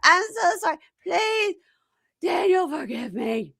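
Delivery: high whimper fading to breath; mock crying plea. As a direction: beg in a hammed-up sob, running out of voice.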